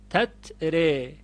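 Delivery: تتره said slowly with final e; slow تتره with imala. Shown as a demonstration. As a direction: draw out تتره toward e. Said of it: "به اماله".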